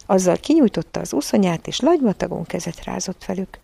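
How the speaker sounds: ordinary delivery, nothing marked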